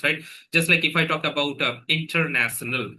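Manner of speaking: usual speaking voice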